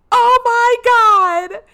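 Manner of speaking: hammed-up high wail, drawn out; crying out. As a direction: shriek it in mock despair. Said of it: "And she starts crying".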